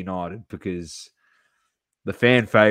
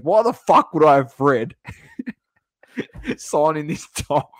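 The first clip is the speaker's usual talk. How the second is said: louder, higher mock outrage; mates's indignant retort; 6 semitones higher, 6 dB louder than usual. Mate scoffs at a signed Fred jersey, loud mocking outrage. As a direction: play the mate scoffing at the very idea. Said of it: "He goes".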